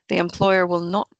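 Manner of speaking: ordinary talk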